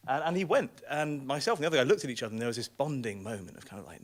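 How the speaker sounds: ordinary talk, nothing marked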